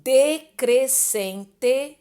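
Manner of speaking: decrescente stretched very slowly syllable by syllable; drawn-out word. Shown as a demonstration. Teacher stretching decrescente so the students catch every syllable. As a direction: draw out decrescente for the class. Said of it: "precedidas de ditongo".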